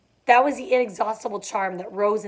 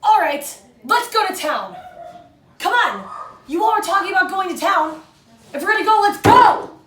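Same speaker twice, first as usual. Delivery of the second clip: higher, louder exasperated outburst; Tom freaking out; 10 semitones higher, 6 dB louder than usual. Shown as a demonstration. Teacher rereading Tom freaking out, building to a yell. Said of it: "we're gonna take a look at that".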